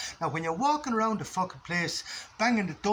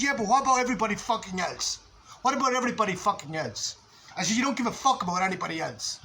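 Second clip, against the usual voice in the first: angry retort; 5 semitones higher than usual. Retold or imitated. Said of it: "anger is now an appropriate response".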